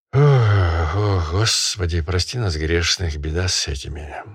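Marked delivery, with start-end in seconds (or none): none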